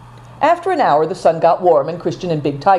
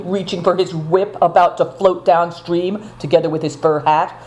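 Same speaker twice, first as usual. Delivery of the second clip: usual speaking voice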